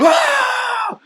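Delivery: high loud yell of fury; enraged scream. Retold or imitated. Screaming at the teammate in frustration, high yell. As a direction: let out a raging gamer scream.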